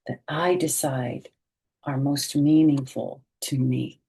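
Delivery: bright, expressive picture-book read-aloud; reading to children. A narrator's reading. Teacher reading a picture book aloud to children, expressive. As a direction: read it slowly and warmly to young listeners.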